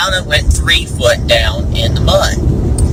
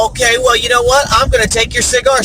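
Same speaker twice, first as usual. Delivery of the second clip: higher-pitched comic retort; mock defiance; 7 semitones higher than usual. Passenger threatens to take pilot's cigar, mock defiant. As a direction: hit back in a lightly acted huff. Passenger retaliates in acted quarrel.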